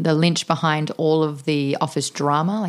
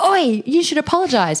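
high sharp mock call-out; retold call-out; 9 semitones higher than usual. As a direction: call him out to apologize. Confronting a guy at bar.

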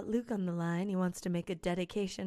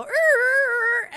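brake squeal; 12 dB louder than usual. Pretend.

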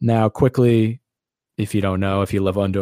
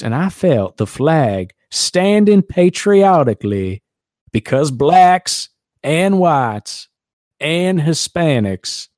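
slow, much higher mock Southern drawl; hammed-up Southern accent; 3 semitones higher, 3 dB louder, slower than usual. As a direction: stretch the words like a folksy Mississippi quarterback. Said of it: "when I read what he said in that accent".

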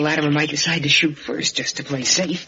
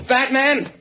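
calling out; 9 semitones higher, slower than usual. Acted. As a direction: shout his name as you search for him. Searching for missing Batman.

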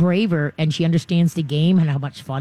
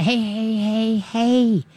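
firm correction; 4 semitones higher, much slower than usual. Shown as a demonstration. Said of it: "screaming and that".

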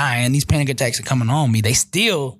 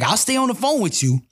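higher, insistent mom voice; a mother's higher, no-nonsense command; voicing his mother; 8 semitones higher than usual. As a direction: play the firm mother ordering her son out. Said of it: "She's like".

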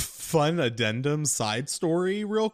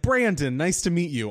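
eager mechanic; 5 semitones higher, 5 dB louder than usual. Retold or imitated. Tony misnaming Grant.

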